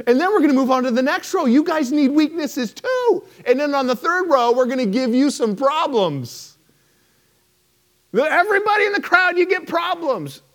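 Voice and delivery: high, loud game-show host; Oprah announcing. Retold or imitated. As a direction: hype it like a giveaway host. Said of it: "we're gonna start right here in the front row".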